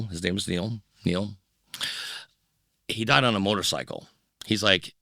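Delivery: ordinary delivery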